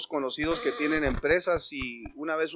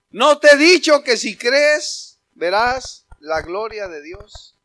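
insistent wife; 3 semitones higher, 9 dB louder, slower than usual. Acted. Wife insisting to her husband, slow and insistent. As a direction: press the promise on him.

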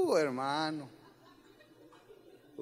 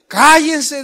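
loud high bark; shouted order; 8 semitones higher, 16 dB louder than usual. Shown as a demonstration. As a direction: yell it at them.

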